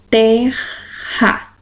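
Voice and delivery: "Terra" split in two, ter-ra; sílaba por sílaba. Shown as a demonstration. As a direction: say "Terra" as two syllables with a pause between. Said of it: "ele também deve estar separado". ("Terra" separado em duas sílabas, com pausa)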